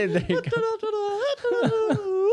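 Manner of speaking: squeaky puppet giggling; high puppet laugh. Acted. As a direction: cackle it high and bright as the puppet.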